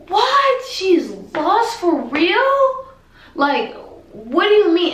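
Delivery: very high, loud, drawn-out shocked cry; panicked disbelief. Retold or imitated. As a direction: stretch it out high in alarm. Panicked reaction mom is lost.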